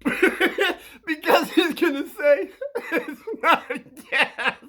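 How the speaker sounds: high, loud laughter through the words; cracking up. Real, not acted. Predicting the other kid's reply, cracking up. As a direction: struggle to get it out while laughing. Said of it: "trying to keep a straight face".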